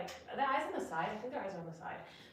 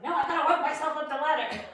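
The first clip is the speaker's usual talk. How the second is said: laughing mock resolve; 6 semitones higher, 8 dB louder than usual. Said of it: "I was like".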